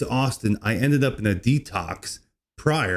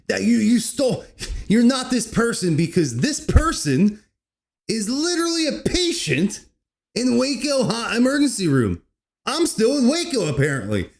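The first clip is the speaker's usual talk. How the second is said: excited retelling; 10 semitones higher than usual. Retold or imitated. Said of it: "he goes".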